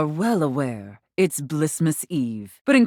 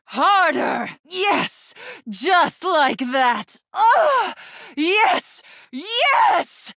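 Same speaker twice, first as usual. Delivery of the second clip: ecstatic cries; 12 semitones higher, much slower than usual. Acted. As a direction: let go in rising ecstatic shouts. Santa cries out in pleasure.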